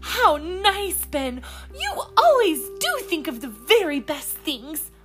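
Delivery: high, loud delighted praise. Acted. Polly praising Ben's menu, high. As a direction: gush over his choice.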